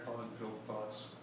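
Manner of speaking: ordinary talk, nothing marked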